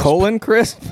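high, disbelieving question; can't believe the name. Real, not acted. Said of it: "Is that really his name?".

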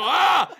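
high, loud comic scream; hammed-up scream of panic; mock freak-out scream. Soldiers panicking for Napoleon, loud mock scream. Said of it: "they have a whole freak out".

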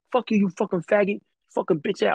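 hammed-up furious yell; retold angry yell. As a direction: bark it like the raging coworker. Crazy coworker yelling slurs.

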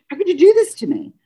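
high, pained retold cry of hurt; plead, voice lifting in hurt; hurt plea. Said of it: "And I started to cry".

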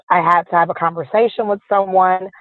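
ordinary delivery

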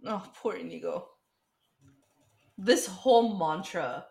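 ordinary talk, nothing marked

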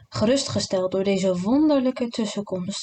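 ordinary delivery, nothing marked

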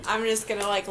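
ordinary talk

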